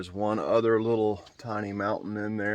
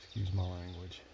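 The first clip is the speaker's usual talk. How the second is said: hushed; 8 dB quieter than usual. Real, not acted. Staying quiet near the bear.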